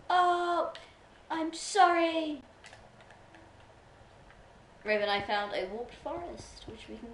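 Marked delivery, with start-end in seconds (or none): 0.1-2.4 high, loud, drawn-out apology; mock wailing apology. Joker apologising after a mom joke, mock wail.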